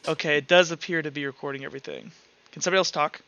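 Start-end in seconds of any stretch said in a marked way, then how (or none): none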